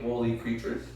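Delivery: usual speaking voice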